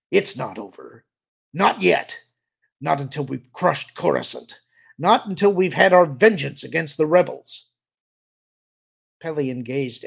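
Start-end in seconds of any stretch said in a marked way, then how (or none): 0.1-7.7 angry insistence building louder and higher; snarling defiance. A narrator's reading. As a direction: refuse defeat, building to a vengeful cry. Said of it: "he snarled".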